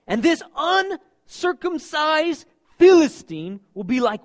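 high, loud, bold scripture read; preaching at full force. Acted. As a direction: proclaim David's confidence. Reads David's words with force.

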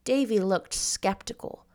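plain reading voice

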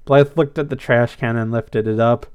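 plain reading voice

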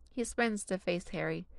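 ordinary delivery

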